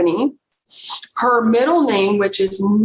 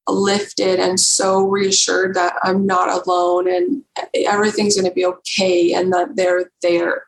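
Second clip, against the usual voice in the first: normal talking voice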